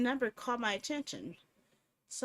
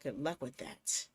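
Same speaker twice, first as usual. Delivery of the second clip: deep gruff villain on the phone; gruff menacing caller; 10 semitones lower, 7 dB quieter than usual. Acted. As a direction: growl it like a faceless stalker. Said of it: "a hoarse, gruff voice".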